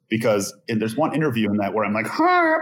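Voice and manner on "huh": high nasal "huh" of his younger self; mock younger high voice; old high voice. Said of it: "higher pitched nasal, more nasal voice".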